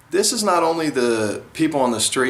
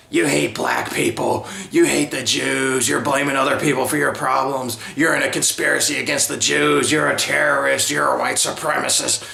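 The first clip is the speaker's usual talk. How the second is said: mock accusers. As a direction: list the accusations like a self-righteous accuser. People accusing him of hatred.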